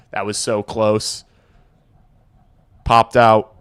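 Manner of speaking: ordinary talk, nothing marked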